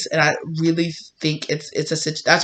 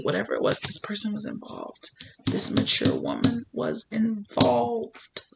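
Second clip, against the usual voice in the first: higher, softer, urgent racing thought; hushed dread as it clicks; panicked inner voice; 3 semitones higher, 5 dB quieter than usual. Acted.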